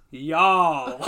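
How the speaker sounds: hammed-up rooster drawl; Foghorn Leghorn. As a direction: imitate a blustery Southern cartoon. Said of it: "it's just like Foghorn Leghorn".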